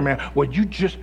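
ordinary talk

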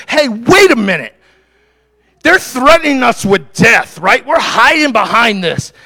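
high, emphatic sermon delivery; fired-up preaching. Acted. Preacher voicing believers losing their fear of death, fired-up and high. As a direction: preach it as a bold, rousing declaration.

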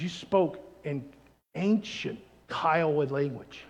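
low, slow, soft awe; hushed awe. Acted. Kiowa man to seatmate, hushed awe. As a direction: let it out slowly, in wonder. Tearful man's awed revelation retold.

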